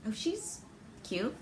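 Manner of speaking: soft, murmured aside to herself; smitten inner thought. Acted. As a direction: think it quietly to yourself. Acted private thought on seeing girl.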